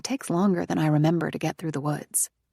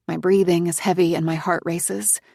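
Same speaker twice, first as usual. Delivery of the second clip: usual speaking voice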